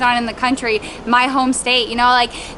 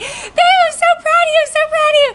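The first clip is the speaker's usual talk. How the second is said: very high, gushing cheer; imitated excited praise; 4 dB louder than usual. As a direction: gush with pride, mimicking an excited husband. Husband running up to praise her.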